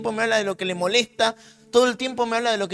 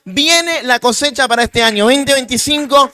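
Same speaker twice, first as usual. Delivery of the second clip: louder, rising preaching climax; predicación a pleno pulmón; 3 semitones higher, 10 dB louder than usual. Real, not acted. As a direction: proclaim the harvest with full force. Predicación a todo volumen. (predicando con toda la fuerza)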